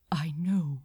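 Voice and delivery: a calm unvoiced whisper. A narrator's reading. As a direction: answer calmly under the breath. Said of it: "equally calmly".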